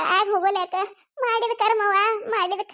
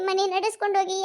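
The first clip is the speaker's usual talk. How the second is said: ordinary talk, nothing marked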